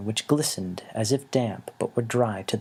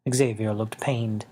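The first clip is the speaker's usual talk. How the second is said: usual speaking voice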